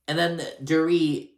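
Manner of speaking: usual speaking voice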